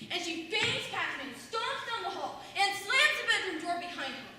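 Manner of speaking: usual speaking voice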